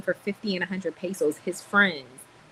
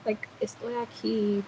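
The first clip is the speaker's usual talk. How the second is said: higher, put-on pitying voice in Spanish; mock tender comforter; 4 semitones higher than usual. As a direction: coo over him like a pitying aunt, half joking. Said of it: "and be like".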